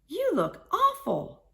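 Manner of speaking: Mama Hyena exclaims at her dirty daughter; mother's shocked cry. A narrator's reading. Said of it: "cried Mama Hyena".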